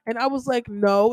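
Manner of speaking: ordinary talk, nothing marked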